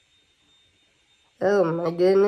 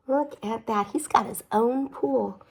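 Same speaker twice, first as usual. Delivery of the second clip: plain reading voice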